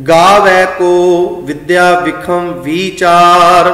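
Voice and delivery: slow, sustained verse reading; scripture recitation. Shown as a demonstration. Teacher reads the next line, slow recitation. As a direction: recite with long held vowels. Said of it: "ਤੇ ਅਗਲੀ ਪੰਕਤੀ ਹੈ".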